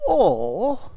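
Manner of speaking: loud, swooping, drawn-out word; self-important build-up. Retold or imitated. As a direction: puff yourself up for a grand announcement. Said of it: "then building himself up".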